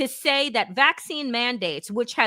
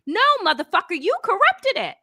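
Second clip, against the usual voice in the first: sharp, higher-pitched angry comeback; angry retort; 8 semitones higher, faster than usual. Real, not acted. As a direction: fire it straight back at him, outraged. Retorting angrily to official's claim.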